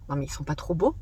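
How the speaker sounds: low, soft voice of a doubter; dismissive aside. Pretend. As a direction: mutter it like an imagined critic. Imagined viewer dismissing the masks.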